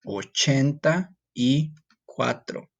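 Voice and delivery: Sesenta y siete at a slow, deliberate pace; slow Spanish. Shown as a demonstration. Teacher slowly modelling the number for students. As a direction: drag each word out for learners. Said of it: "would be ochenta y".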